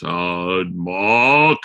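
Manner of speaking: a light take on Broden drawling the name; mock Broden, saying the name slowly; a lightly voiced impression of a friend. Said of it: "when Broden does his voice".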